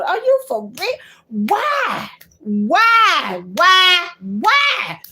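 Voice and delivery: high, loud disbelieving cries of why; crying out in disbelief; exasperated outcry. Real, not acted. To those wanting her killed, high loud exasperated cries.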